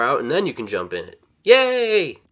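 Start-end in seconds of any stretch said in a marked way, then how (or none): 1.4-2.2 high, loud drawn-out yay; high-pitched gleeful cheer; playful cheer. Pretend. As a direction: whoop it like a kid let loose. Mock cheer on jumping in.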